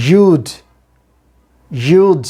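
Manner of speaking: emphatic you'd with long oo and final d; model pronunciation. Shown as a demonstration. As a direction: drill you'd slowly and firmly. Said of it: "দুটোই কিন্তু একটু critical sound".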